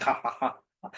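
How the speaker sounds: spoken ha ha ha ha, not a real laugh; audience's dutiful ha-ha; mock laugh. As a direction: fake a polite little laugh. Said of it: "everyone's like".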